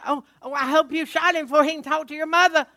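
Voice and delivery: loud, high, frantic plea in a changed voice; hammed-up panic, begging; frantic dad. Father begging son about the dog, loud and frantic. Father fears wife will learn.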